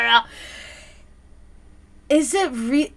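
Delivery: ordinary talk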